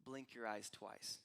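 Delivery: low, soft, slow aside; subtle. Acted. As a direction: say it quietly and slowly, almost in passing. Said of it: "he just subtly says".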